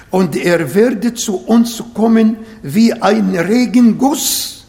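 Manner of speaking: ordinary talk, nothing marked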